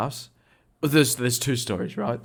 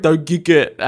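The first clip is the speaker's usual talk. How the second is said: loud, raised mock plea; protesting shout; 6 semitones higher, 9 dB louder than usual. Cat owner to tradesman kicking the cat, retold loud protest. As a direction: call out to stop the kick.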